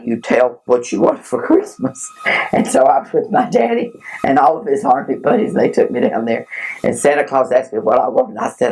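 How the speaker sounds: ordinary talk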